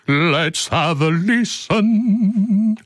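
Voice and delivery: high pitched and stretched way out; high, very slow drawl. Shown as a demonstration. Host hamming up a retake, high and slow. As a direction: drag the words out up high. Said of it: "repeated takes".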